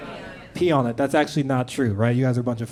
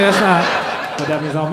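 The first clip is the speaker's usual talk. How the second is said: exasperated laugh; 4 dB louder, slower than usual. Retold or imitated. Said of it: "And I was like".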